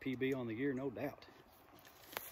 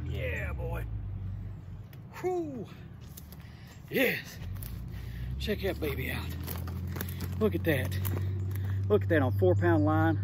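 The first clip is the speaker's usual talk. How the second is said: real thrill over a fresh catch, rising high; excited; 6 semitones higher, 5 dB quieter, slower than usual. Real, not acted. Angler thrilled by his catch, excited. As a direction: crow with delight at the fish. Landing a big bass on camera.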